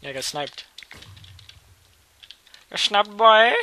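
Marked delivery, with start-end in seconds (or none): 2.7-3.5 much louder, higher triumphant taunt; gloating shout. Real, not acted. Gamer taunting the rival he just sniped, gloating shout. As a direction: crow it at him.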